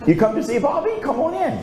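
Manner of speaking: high, bright welcoming mom voice; high-pitched friendly mother welcome; mother's greeting. Retold or imitated. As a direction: greet him like a cheerful mom at the door. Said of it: "She says".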